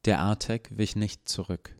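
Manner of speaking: plain reading voice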